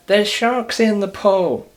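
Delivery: mock shout. Someone warns swimmers about sharks in a loud, slow shout. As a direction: yell the warning across the pool. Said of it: "when someone shouts".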